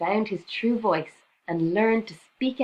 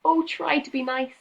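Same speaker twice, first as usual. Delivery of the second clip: high, pleading whimper; a big lion whimpering, much higher; 9 semitones higher, 4 dB louder than usual. A narrator's reading. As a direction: beg like a scared kitten. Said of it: "he whimpered".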